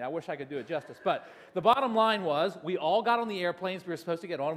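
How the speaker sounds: ordinary talk, nothing marked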